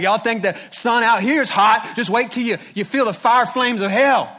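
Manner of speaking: mock hellfire rant, loud and high; shouted fire-and-brimstone parody; screaming street preacher. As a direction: holler as if through a cheap megaphone. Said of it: "start screaming through it".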